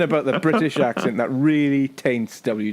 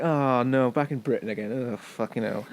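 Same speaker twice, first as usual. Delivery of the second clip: sinking, quiet disappointment; mock dejection; 4 dB quieter than usual. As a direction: deflate like a holiday just ended. Acted letdown at hearing British advert.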